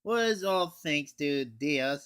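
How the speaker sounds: normal talking voice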